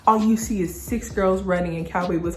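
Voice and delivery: usual speaking voice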